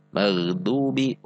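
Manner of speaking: maghdubi with a light tongue-tip D; dal in maghdubi. Shown as a demonstration. Contrasting dal for learners, slow light-D maghdubi. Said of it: "dengan huruf dal".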